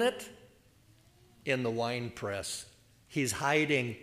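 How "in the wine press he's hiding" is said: usual speaking voice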